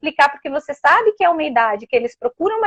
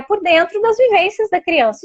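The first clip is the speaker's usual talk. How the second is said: normal talking voice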